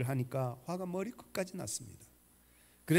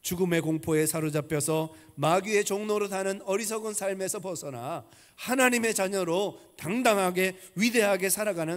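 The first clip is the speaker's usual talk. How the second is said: raised, fervent sermon delivery; louder preacher's benediction; preaching blessing; 3 semitones higher, 4 dB louder than usual. Acted. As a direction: proclaim the blessing over the congregation. Closing blessing, full preaching.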